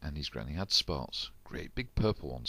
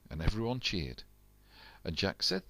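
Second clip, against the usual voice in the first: ordinary delivery, nothing marked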